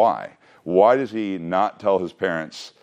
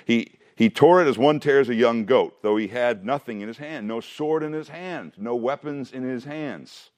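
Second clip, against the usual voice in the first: reading the verse aloud, a little higher; scripture reading; 7 semitones higher than usual. Acted. Teacher reading Samson's story aloud to his class, steady reading voice. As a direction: read the Bible passage to the class.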